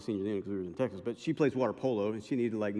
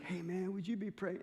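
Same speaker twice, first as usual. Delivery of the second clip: soft plea; 6 semitones higher, 9 dB quieter than usual. Retold or imitated. Big man asking, near tears.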